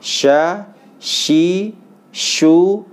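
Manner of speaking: teacher drilling shin; makhraj drill. Shown as a demonstration. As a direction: chant each syllable clearly. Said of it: "was tulisan ya".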